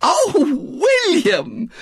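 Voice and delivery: high, swooning exclamation; fervent. A narrator's reading. Missus Bott turns gratefully to William, fervent. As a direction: cry it with overflowing feeling. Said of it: "said Missus Bott fervently".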